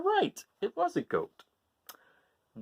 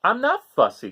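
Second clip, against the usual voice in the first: put-on higher, louder kid's protest; Gregory protesting; 8 semitones higher, 7 dB louder than usual. Gregory defending himself to his parents, loud protest. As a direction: protest like an indignant child. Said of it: "said Gregory".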